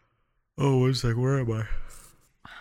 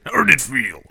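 hammed-up ragged, higher and louder; 7 semitones higher, 8 dB louder than usual. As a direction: say it raggedly, half laughing. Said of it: "That's how he said raggedly".

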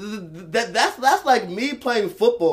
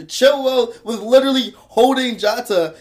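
slower and louder, higher, hammering each word home; louder and slower, outraged at the defending; heated emphasis; 5 semitones higher, 8 dB louder than usual. Real, not acted. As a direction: spell out the foul, indignant and loud. Heated football argument.

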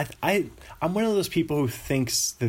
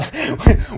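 burst of laughter; 13 semitones higher, 9 dB louder than usual. Real, not acted. Laughs at own confusion.

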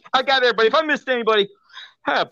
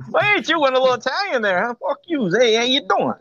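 hammed-up Italian-American New York accent; put-on New York Italian accent; Italian New Yorker accent; faster than usual. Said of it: "He went Italian".

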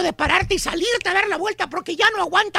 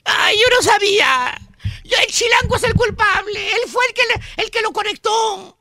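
mock crying; 3 dB louder than usual. Arrested Turqui blaming someone else, mock crying. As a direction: whine a fake sobbing excuse.

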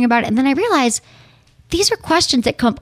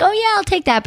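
housekeeper voice; 10 semitones higher than usual. Retold or imitated. Housekeeper quoted picking up toy.

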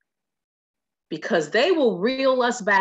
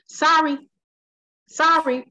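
raised-pitch mimicked "sorry"; mock casual apology; 6 semitones higher than usual. Host imitating people who think they're clever dropping "I'm", mock casual apology. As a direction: toss out a mock sorry, higher than usual.

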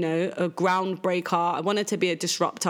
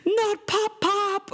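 high, drawn-out mock wail; imitated child's scream; much slower than usual. Mum imitates her daughter upset over bagged presents, high mock scream. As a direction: mimic a screaming child in a high voice, no real shouting. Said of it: "She's now screaming".

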